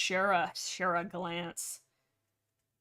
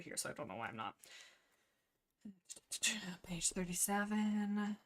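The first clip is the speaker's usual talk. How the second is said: whisper; 10 dB quieter than usual. Real, not acted. Streamer searching her notes, soft whisper.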